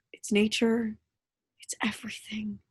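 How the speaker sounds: hushed intimate whisper. Acted. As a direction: whisper it close to his ear. Intimate plea in bed scene.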